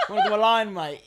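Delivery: loud mock Australian slipping into British; British-sounding Aussie accent. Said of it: "that sounded so British".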